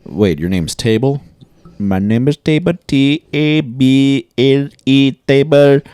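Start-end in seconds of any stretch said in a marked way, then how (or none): 1.8-5.8 higher, very slow spelling out the name; patient over-polite phone rep; mock rep spelling it out.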